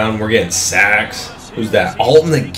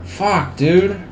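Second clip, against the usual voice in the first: higher, anguished curse; pained groan; 8 semitones higher, 3 dB quieter than usual. Real, not acted. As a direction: wince at your own blunder. His late throw gets picked.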